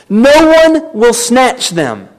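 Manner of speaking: slow, each word weighted, stress on one; slowed scripture emphasis on one; slow emphatic. Shown as a demonstration. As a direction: spell out No one will snatch them slowly. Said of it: "Notice his wording".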